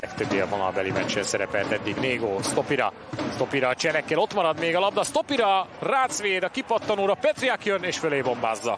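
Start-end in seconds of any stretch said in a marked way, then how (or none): 3.3-8.8 excited commentary. Real, not acted. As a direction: shout the chance as it unfolds. Loud excited chance call. (hangos, izgatott közvetítés)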